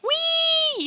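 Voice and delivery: put-on high squealing wee of a small child; gleeful kid squeal. Little girl on the plane loving the drop, high gleeful wee. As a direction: squeal it like a five-year-old on a rollercoaster. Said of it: "She'd be like".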